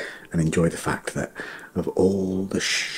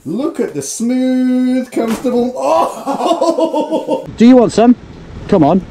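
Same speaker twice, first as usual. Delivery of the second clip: laughing; 12 dB louder, slower than usual. Real, not acted. Mocking grim scene, laughing.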